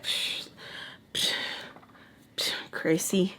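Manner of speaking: breathed, scoffing whisper with long gaps; hushed brush-off. Retold or imitated. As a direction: imitate a dismissive whispered scoff. Retold scornful brush-off.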